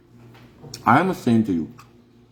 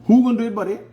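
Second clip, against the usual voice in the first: high, loud rhetorical demand; loud high challenge, buddy; 10 semitones higher, 3 dB louder, much faster than usual. Real, not acted. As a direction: call them out, who will do it.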